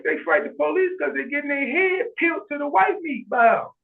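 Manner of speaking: high-pitched, incredulous comeback; retold protest, pitch riding high throughout; indignant retort. Answering cousin on why youths fight police, mock indignant.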